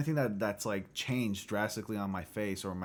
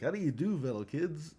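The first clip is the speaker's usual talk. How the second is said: higher, cheery mock greeting to the kids; fellow kids meme; 5 semitones higher than usual. Himself back at school greeting younger students, mock cheery. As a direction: pose as a teen to fit in. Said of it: "I'm gonna be in the hallways going like".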